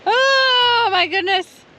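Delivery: high, loud cry of dismay; dismayed exclamation. Real, not acted. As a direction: gasp at the size of the hill. Faced with a huge hill.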